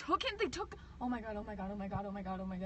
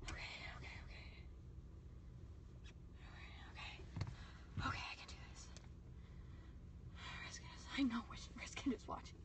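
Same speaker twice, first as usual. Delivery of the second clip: whisper; 13 dB quieter than usual. Real, not acted. Hiding, calming herself.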